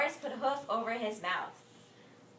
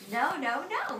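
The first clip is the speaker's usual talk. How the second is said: louder, higher no no no; impatient shout; 6 semitones higher, 6 dB louder than usual. Acted. Boris rejecting Morris's wrong answer, impatient shout. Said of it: "Boris shouted".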